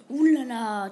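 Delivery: a loud, startled ouh là là. Real, not acted. Reading the chatbot's answer, loudly startled. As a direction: jump at the reply. Reacting to the app's reply.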